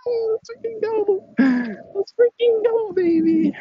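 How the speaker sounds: very high squealed cheer; re-enacted giddy victory cry; hyped. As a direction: celebrate in a strained, squeaky falsetto. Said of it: "that got me hyped".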